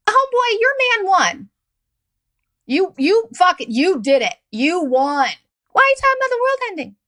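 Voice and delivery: high, fast, incredulous ranting; mock exasperated rant. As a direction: throw it at him like you can't believe him. Mocking rant at the Trump fan.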